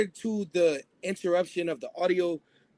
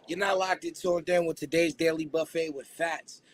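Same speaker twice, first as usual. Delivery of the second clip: usual speaking voice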